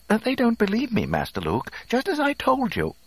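very high, fussy robot complaint; flustered droid. A narrator's reading. Flustered droid complains to his master.

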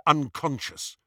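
ordinary delivery, nothing marked

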